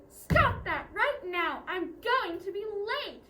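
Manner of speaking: loud, slow, drawn-out scolding; stern. Acted. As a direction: scold them sternly, punching each word. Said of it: "Or stern language".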